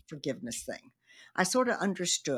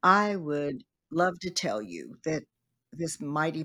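normal talking voice

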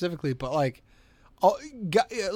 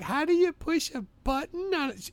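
mom voice; 12 semitones higher than usual. Retold or imitated. Mom quoted struggling with app.

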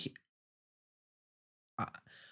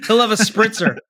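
mock order; 5 semitones higher than usual. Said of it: "I guess I am".

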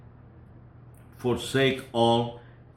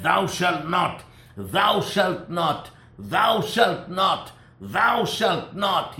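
higher, slow, booming commandments; terrible voice of the law; 7 semitones higher, 4 dB louder, slower than usual. Acted. God speaking from Mount Sinai to the people, a terrible booming voice. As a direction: hand down the law like thunder from the mountain. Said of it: "that voice was so terrible".

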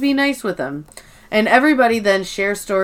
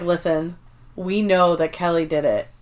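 lightly imitated coworker, softer and lower; Oscar; 5 semitones lower, 7 dB quieter than usual. Coworker refusing to go against a colleague, low understated voice. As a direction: drop low and quiet, as if warning privately. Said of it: "And then Oscar's like".

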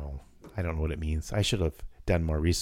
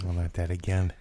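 normal talking voice

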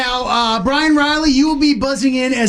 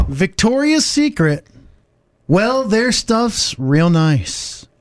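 slow, dramatic poetry reading; poetic reading; 3 semitones higher, much slower than usual. Acted. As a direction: recite it like a fireside poem. Reads lyrics as poetry.

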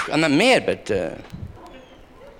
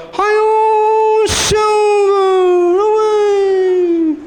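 much higher, loud, slow "Hi-Yo Silver" call; hammed-up radio cry; 4 dB louder, much slower than usual. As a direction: belt it out like the show's hero riding off. The radio show's famous cry.

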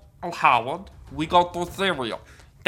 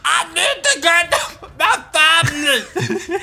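laughing panic; 10 semitones higher, slower than usual. Acted. Panicked role in zombie apocalypse.

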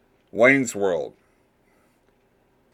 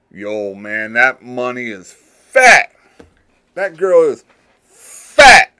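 slangy dude voice; 3 semitones higher, 8 dB louder, slower than usual. Retold or imitated. Podcaster demoing "fat" as a compliment, slow and rising. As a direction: stretch it out like a nineties kid bragging.

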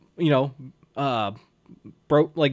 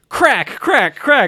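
loud, high, punched "crack" for each board; imitated cracking; 8 semitones higher, 7 dB louder, much slower than usual. Co-host retelling Daniel smashing the boards, loud imitated cracks.

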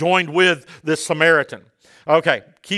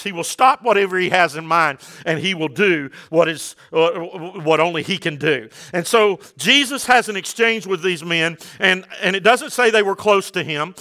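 ordinary talk

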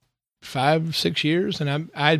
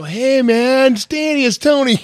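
high, loud goofy caller voice; imitating a phone greeting; 10 semitones higher, 4 dB louder than usual. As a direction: mimic him with a big high-pitched hello. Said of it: "he'll, he'll go".

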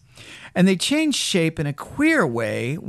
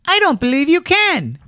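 high, loud taunting rabbit; scornful high-pitched dare; doubting wild rabbit; 14 semitones higher, 5 dB louder than usual. A narrator's reading. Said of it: "he said".

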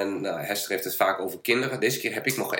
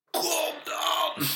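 mock shouted challenge; 4 dB quieter than usual. Imitating the small kid who yelled at him, mock shout. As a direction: squeal it like a little kid picking a fight. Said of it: "die schreeuwt gewoon tegen mij".

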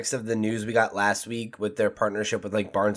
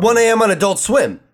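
high, loud mocking voice; squeaky mock late-night promo; mock announcer; 11 semitones higher, 6 dB louder than usual.

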